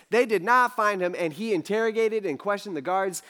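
ordinary talk, nothing marked

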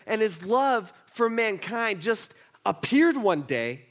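slow, deliberate verse with long pauses; emphatic scripture reading. Real, not acted. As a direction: savour each phrase of the verse.

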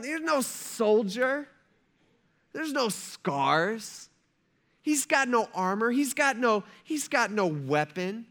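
high, drawn-out dismay; Saul. Retold or imitated. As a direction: list each lack slowly, in mock disbelief. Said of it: "the disappointment on Saul's face".

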